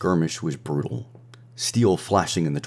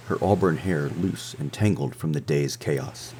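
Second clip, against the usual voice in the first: usual speaking voice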